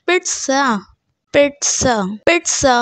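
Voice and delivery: pizza as PIT-sa, crisp ts; correct pronunciation. Shown as a demonstration. Teacher repeating the word for students, correctly pronounced. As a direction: say pizza with t then s, no z. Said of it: "is the correct pronunciation".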